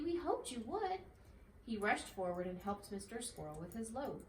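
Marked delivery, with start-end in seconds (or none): 0.0-1.0 excited cry. A narrator's reading. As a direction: greet him with delight. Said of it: "cried Dinky".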